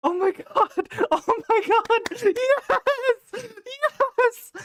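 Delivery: high, laughing, tearful yells; ecstatic cheer. Real, not acted. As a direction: scream with joy. Celebrating the twist.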